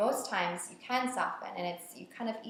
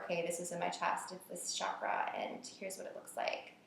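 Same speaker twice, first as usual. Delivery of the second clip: soft inner-voice whisper; 8 dB quieter than usual. Retold or imitated. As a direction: whisper it to yourself. Voicing inner thoughts during practice.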